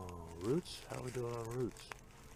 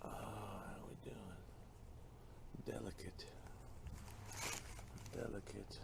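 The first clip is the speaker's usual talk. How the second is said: whisper; 12 dB quieter than usual. Real, not acted. Whispering over delicate plant.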